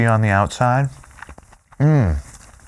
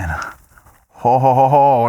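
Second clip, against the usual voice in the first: Santa laugh; 3 dB louder, much slower than usual. Pretend. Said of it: "I can hear the sleigh bells ringing".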